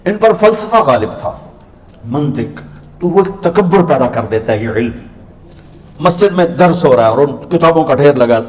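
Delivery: usual speaking voice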